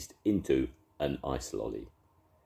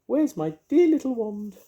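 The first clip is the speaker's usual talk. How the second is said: very high, louder fairy wail; 9 dB louder than usual. A narrator's reading. Mavis wailing over the melting beast, high fairy voice.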